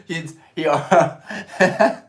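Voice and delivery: breaking into high laughter; laughing. Real, not acted. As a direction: laugh through the words. Laughing at stream chat.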